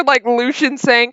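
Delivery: ordinary talk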